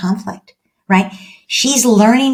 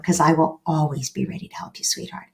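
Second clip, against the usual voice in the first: softer, tender parent's promise; tender reassurance; 3 semitones lower, 8 dB quieter than usual. Retold or imitated. Mom reassuring her teen daughter she will always help, softly. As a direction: reassure your daughter gently, trailing soft.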